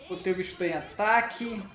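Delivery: ordinary talk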